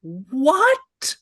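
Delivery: high, astonished what; jaw-dropped what; stunned what. Retold or imitated. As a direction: blurt it out in disbelief. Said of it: "And I'm just like".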